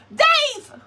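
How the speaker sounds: high loud shout of a name; sharp shouted call; yelling a name. Real, not acted. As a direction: yell across the yard. Yelling at someone nearby.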